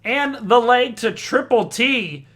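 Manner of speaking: triumphant announcer's cry, stretching the name; game shot call. Real, not acted. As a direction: belt out the leg win like a darts caller. Calls the winning dart.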